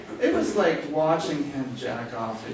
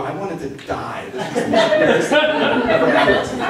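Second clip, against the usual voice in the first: ordinary delivery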